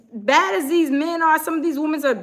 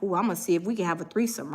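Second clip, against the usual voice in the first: mock [name]; 8 semitones lower, 8 dB quieter, much faster than usual. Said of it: "He went and he was like".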